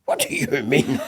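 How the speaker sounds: laughing, half-hammed indignant question; laughing mock outrage. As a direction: play up the offence while laughing. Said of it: "I was outraged".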